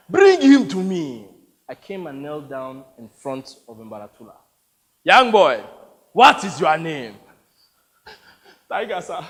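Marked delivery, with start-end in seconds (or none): 0.1-1.3 loud, slow order; commanding warlord. Acted. 5.0-7.2 loud, booming interrogation; imperious warlord. Acted. Warlord questions captive boy.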